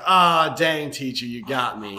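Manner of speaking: put-on boy conceding the bet to his teacher, loud and disappointed; mock disappointment. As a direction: blurt it like a sore loser. Johnny caught losing the bet.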